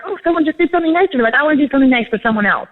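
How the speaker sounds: lightly acted excited inner voice; mock delighted. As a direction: gush it like a happy surprise. Imitating a teacher's happy thought.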